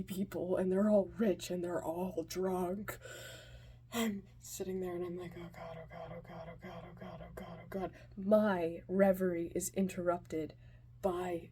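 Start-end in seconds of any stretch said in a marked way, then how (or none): 5.4-7.9 very soft anxious murmur, repeated like a mantra; panicked muttering. Retold or imitated. Scared kid alone among rich drunks, panicked muttering. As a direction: mutter it under your breath in dread.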